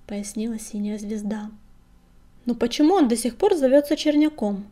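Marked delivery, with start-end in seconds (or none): 2.4-4.6 higher, louder hint of a nagging old cat; lightly voiced old crone who won't let it go; persistent old woman. A narrator's reading. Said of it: "не унималась старуха". (настырная старуха, слегка)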